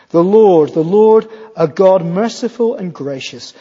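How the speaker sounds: loud, slow proclamation of the Lord's name; preacher proclaiming Exodus to the congregation; proclaiming scripture. Acted. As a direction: declare it like a herald. Proclaiming Exodus thirty-four in sermon.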